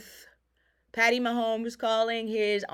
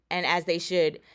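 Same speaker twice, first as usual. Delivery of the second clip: ordinary talk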